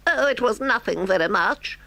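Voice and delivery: high sheepish Toad voice. Acted. Toad downplaying his crime to the driver, high and sheepish. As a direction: brush it off in Toad's high squeak.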